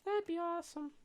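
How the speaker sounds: put-on high little kid voice; small child. Young halfling missing his friends, in a high child voice. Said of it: "this child cannot".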